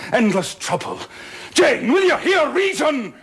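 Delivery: building to a furious high shout; exasperated shout. Acted. Rochester shouting at Jane to hear reason, furious shout. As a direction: lose patience and roar at her. Rochester losing his temper.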